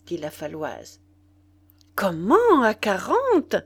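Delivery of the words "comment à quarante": loud, astonished exclamation; stupefied cry. A narrator's reading. Nana hears the new odds from La Faloise, stupefied. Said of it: "cria-t-elle stupéfaite".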